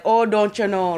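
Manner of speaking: imitated Minnesotan with long round O's; North Dakotan accent. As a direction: put on a cartoon upper-Midwest accent. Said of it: "North Dakotan accent that we think about".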